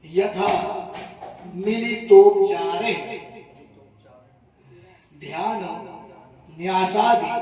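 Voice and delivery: measured, word-by-word Sanskrit intoning; ritual sankalp recitation. Acted. Priest reciting the sankalp for the devotee in measured intoning. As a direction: intone each phrase of the vow with pauses between.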